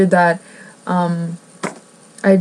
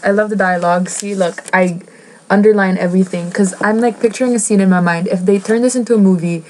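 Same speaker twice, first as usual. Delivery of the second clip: normal talking voice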